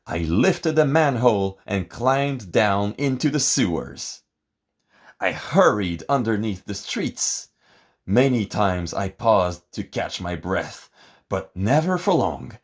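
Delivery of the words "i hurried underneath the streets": ordinary delivery, nothing marked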